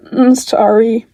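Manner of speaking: plain reading voice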